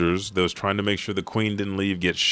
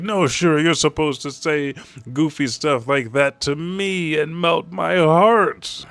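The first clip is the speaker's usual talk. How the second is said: whiny jealous king; 8 semitones higher than usual. Retold or imitated. Jealous king whining to daughter.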